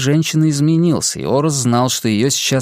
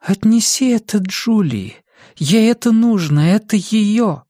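ghostly woman voice; 8 semitones higher than usual. Acted. Dead woman's voice repeats.

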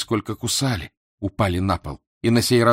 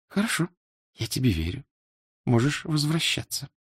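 soft, higher female character voice; quiet, lightly voiced woman; woman's reply; 5 semitones higher, 4 dB quieter than usual. A narrator's reading. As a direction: lift the pitch and speak gently.

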